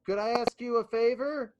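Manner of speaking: high, lilting hail to someone far overhead; high, stretched-out calling voice; calling up to giant. Acted. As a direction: pitch it high and polite, as if asking a colossus. Player calls up to towering giant.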